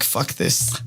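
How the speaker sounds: a muttered quote of her past self; mock whisper. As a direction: toss it off as a hushed aside. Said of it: "It's like".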